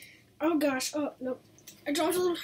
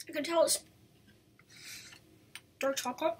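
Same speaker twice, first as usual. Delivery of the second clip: talking between bites; chewing while talking; 4 dB quieter, faster than usual. Real, not acted. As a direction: mouth partly full. Seen 0: Chocolate-smeared lips, chewing motions, holds treat between bites.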